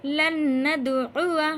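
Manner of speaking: loud, slow chant; recitation demo. Shown as a demonstration. Teacher giving the next example, loud slow recitation. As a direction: recite it firmly, holding the nasal. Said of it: "इसको भी नहीं खींचेंगे".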